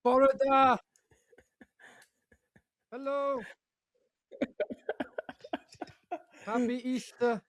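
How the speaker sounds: high, bright festive hello; cheery greeting. Real, not acted. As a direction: greet everyone in a big holiday voice. Arrives in an Easter costume.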